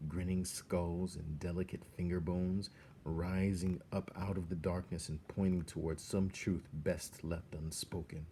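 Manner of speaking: usual speaking voice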